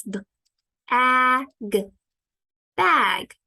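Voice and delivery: B-A-G split, then bag blended; sounding out. Shown as a demonstration. Teacher sounding out bag for students. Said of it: "Let's practice".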